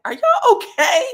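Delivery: high, loud, laughing exclamation; cackling are y'all okay; laughing mock shriek. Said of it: "I was like".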